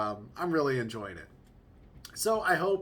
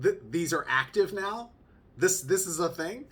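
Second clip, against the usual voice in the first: stammering, higher and louder disbelief; acted amazement; 4 semitones higher, 5 dB louder than usual. Dad discovering baby's tickle spots, acted amazement. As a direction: play up the astonished discovery, pitch climbing.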